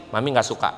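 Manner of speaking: low, quiet, firm correction; serious firm. Shown as a demonstration. Parent correcting a child's rude words, low and serious. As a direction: state it low and final. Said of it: "Muka serius".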